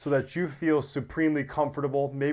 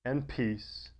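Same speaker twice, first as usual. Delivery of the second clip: guided meditation; 4 semitones lower than usual. Acted. Yoga teacher settling listeners into deep rest, guided meditation. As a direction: ease them gently into rest, low and unhurried.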